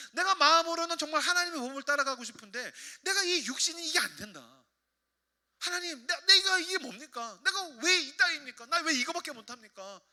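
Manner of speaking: high, loud lament to God; acted despairing prayer, voice pushed high; anguished pleading. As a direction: cry out in frustration at your own weakness.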